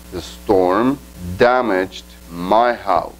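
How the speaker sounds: slow, careful English sentence; slow example reading. Shown as a demonstration. Teacher giving the active-voice version, slow and deliberate. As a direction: read it out slowly for students. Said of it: "Diríamos entonces".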